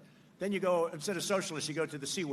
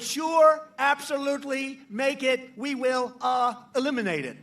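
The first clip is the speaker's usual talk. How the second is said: slow, halting mock Biden, higher and louder; imitating Biden; 3 semitones higher, 5 dB louder, slower than usual. Mocking Biden's fracking pledge to the crowd, slow and halting. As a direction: drag it out like a fumbling old man. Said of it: "Biden said".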